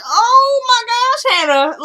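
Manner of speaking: high, drawn-out dismay; exasperated. Retold or imitated. Viewer scolding Hannah over her forgetfulness, exasperated. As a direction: stretch the cry way up high, then drop for the name.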